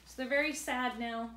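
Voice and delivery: usual speaking voice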